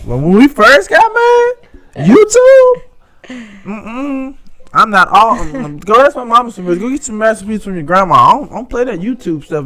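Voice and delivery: a hammed-up dismissive wife voice; imitating wife's old scorn. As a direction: lampoon her dismissing YouTube recipes. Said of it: "now I be like".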